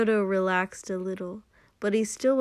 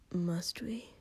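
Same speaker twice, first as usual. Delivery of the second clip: soft, much quieter whisper; 7 dB quieter than usual. Acted. Shoto reluctantly asking his lover, softly whispered. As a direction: barely breathe the question. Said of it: "Shoto said quietly".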